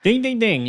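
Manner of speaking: high sing-song ding ding ding; mock game-show chime. Telling his friend he guessed right, high mock chime. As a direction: ring the correct-answer bell, pitched high.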